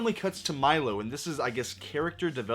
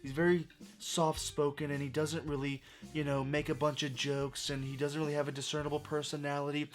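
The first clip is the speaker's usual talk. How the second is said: soft-spoken, reserved; 6 dB quieter than usual. Retold or imitated. Reviewer mimicking a sheltered film character's talk, soft-spoken. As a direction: keep it softly spoken and subdued. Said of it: "he talks like this the whole movie".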